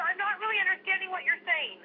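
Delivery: ordinary talk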